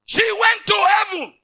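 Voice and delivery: slow, high triumphant shout; preacher shouting the good news; exultant shout. Acted. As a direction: cry it out in triumph. Preacher exclaiming.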